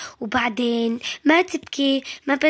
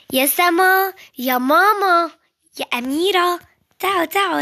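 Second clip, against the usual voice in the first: calling out; 5 semitones higher, 3 dB louder than usual. Acted. Calling family to the door.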